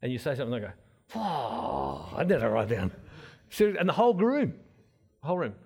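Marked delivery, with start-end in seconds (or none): 1.2-2.1 low, falling mock wow; imitated audience awe. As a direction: imitate a roomful of awed listeners. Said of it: "they're all sitting there like this".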